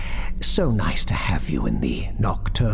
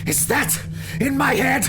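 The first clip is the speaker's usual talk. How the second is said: anguished cry. A narrator's reading. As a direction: cry out in horror, pitched way up. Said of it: "I cried".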